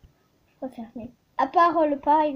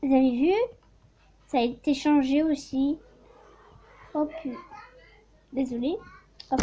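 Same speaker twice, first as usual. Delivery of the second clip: ordinary delivery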